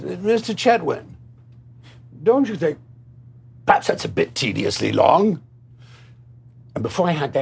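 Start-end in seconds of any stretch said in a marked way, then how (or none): 0.0-5.4 lightly voiced, much higher, fussy writer; a hint of a plummy writer questioning the length; imitating Robert Bolt. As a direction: play the precious screenwriter protecting his words. Retold Robert Bolt objecting.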